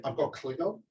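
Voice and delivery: normal talking voice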